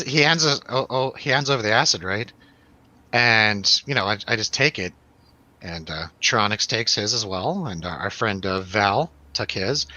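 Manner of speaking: normal talking voice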